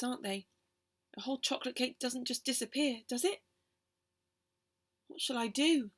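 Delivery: ordinary delivery, nothing marked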